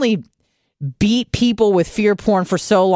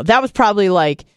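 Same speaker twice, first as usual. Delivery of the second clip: usual speaking voice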